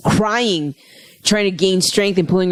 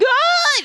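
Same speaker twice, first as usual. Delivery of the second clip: joyful cry. Retold or imitated. Acting out the embrace.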